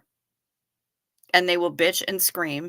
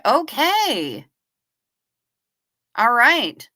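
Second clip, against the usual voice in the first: ordinary talk, nothing marked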